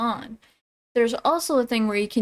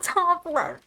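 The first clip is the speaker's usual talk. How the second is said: high-pitched parody of a lyric; mock echo; 10 semitones higher, much slower than usual. Reacting to the song's top left line, high mock echo. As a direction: repeat it high, mocking the line.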